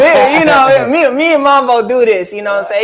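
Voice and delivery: loud, high words still riding the laugh; laughing while talking. Real, not acted. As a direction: keep laughing as you talk about your friend.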